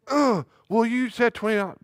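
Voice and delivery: put-on high, loud child protest; squeaky high kid complaint; indignant kid. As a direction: hold Dad to his word like a seven-year-old. Son retorts about the money.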